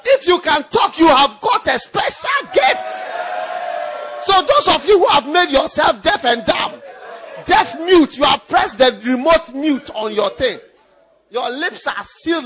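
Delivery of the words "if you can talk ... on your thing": loud, fervent preaching; loud preacher's fervour; preaching at full force. Acted. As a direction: drive it home to the congregation.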